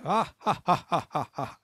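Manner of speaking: high mock genie cackle; genie's laugh. Genie laughing once the wish is made, mock cackle. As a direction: imitate a genie laughing in separate ha-ha bursts. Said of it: "And he goes".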